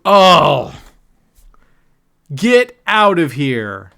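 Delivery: loud, drawn-out groan of protest; real exasperated outburst; outraged disbelief. Real, not acted. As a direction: shout it at the rigged wheel. Loses again on the spin.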